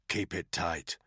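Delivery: a touch of a low warning. Acted. Soldier warning his comrade, mild low warning. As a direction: quietly caution her. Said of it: "Danny warned her".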